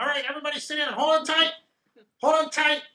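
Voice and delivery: high, excited little driver; chirpy eager call to hold on; gnome driver voice. Acted. GM voices driver starting sled.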